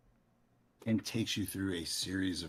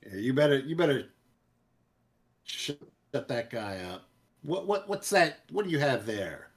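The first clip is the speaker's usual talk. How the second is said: gruff guard voice; 6 semitones higher, 6 dB louder than usual. Acted. Guard voiced, loud and demanding.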